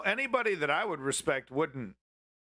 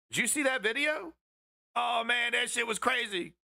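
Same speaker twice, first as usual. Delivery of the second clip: higher, put-on stoner voice; Chem Goblin voice; 8 semitones higher than usual. Streamer mimicking his friend Chem Goblin's voice, higher and goofy. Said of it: "That's how you talk, Chem Goblin".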